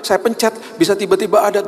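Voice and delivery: high, eager voice; a high, amazed passenger; excited retelling. Retold or imitated. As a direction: tell him the coincidence eagerly. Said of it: "saya juga bilang".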